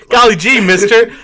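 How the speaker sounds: put-on high, loud gee-whiz kid voice; hammed-up starstruck little boy; wholesome 1950s kid. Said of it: "He's like a seven-year-old with a baseball cap".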